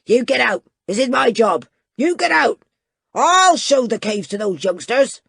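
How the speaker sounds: loud, furious roar; roaring in fury. Acted. Ebenezer roars at Jeremiah to get out of his cave. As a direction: drive the rival out with rage. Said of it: "he roared in fury".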